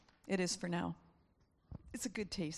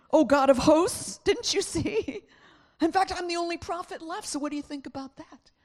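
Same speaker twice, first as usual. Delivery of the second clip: protesting Elijah; 9 semitones higher, 8 dB louder than usual. Acted. Elijah protesting to God that he is alone, high and loud. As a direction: drag it out like a wronged prophet.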